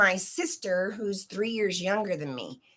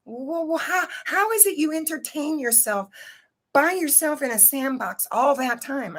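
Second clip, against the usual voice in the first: put-on raised higher, a mother puzzled at her lonely child; mom's quizzing voice; 7 semitones higher than usual. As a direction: ask it like a baffled mom, pitched up. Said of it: "And my mom said".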